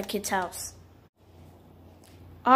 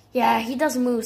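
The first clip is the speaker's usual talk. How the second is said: ordinary talk, nothing marked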